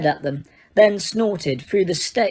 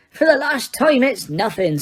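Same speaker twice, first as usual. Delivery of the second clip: firm; 6 semitones higher than usual. A narrator's reading. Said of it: "said Hagrid firmly".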